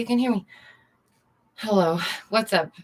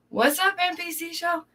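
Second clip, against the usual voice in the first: high excited greeting; 10 semitones higher than usual. Real, not acted. Greeting a viewer by name.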